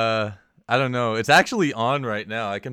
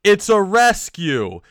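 slow, loud and higher, flatly matter-of-fact; curt neighbor; 8 semitones higher, 8 dB louder, much slower than usual. Retold or imitated. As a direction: lay out the excuse slowly as if it settles everything. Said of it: "he goes".

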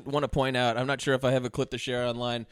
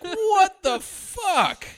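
high, long drawn-out bafflement; mock disbelief; 4 dB louder, much slower than usual. Playing a viewer reacting to the nutty lawyer, mock stunned. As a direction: stretch it out in hammed-up astonishment.

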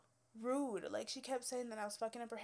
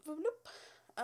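silly bloop; 6 semitones higher than usual. Pretend. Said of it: "moving on".

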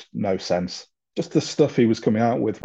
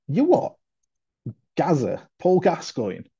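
retold disbelief; 7 semitones higher than usual. Retold shocked reaction to news.

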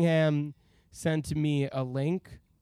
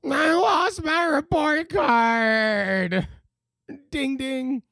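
put-on much higher, slow, louder whiny child voice; goofy kid voice; 9 semitones higher, 4 dB louder, much slower than usual. Host clowns about a lost report card in a silly high child voice. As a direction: whine it like a little kid.

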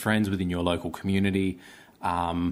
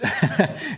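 short laugh; 10 semitones higher, 4 dB louder than usual. Real, not acted. Laughs about Christmas shows.

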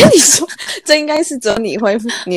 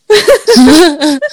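helpless laugh; 7 semitones higher, 3 dB louder than usual. Real, not acted. Cracks up again mid-show.

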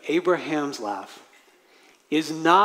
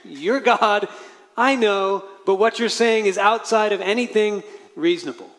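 incredulous; 5 semitones higher, 3 dB louder than usual. Retold or imitated.

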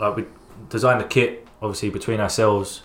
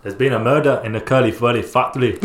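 Scottish accent. Shown as a demonstration. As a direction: belt it out like a Glasgow detective in a Scottish accent. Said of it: "can you say in a Scottish accent".

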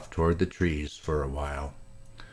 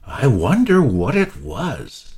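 exclaimed; 7 semitones higher, 7 dB louder than usual. Acted. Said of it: "exclaimed Peter".

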